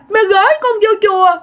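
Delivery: put-on high, sobbing toddler complaint; crying child voice. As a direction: sulk and cry like a small child left behind. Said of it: "Vừa khóc mà vừa nói nha".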